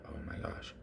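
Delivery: whispered stunned gasp; shocked whisper. Retold or imitated. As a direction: mom stunned, barely breathing it. Mom hearing news.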